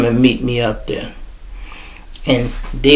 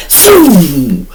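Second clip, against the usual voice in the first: imitated shot whoosh; 3 semitones higher than usual. Imitating bullet whizzing by.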